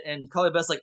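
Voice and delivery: ordinary talk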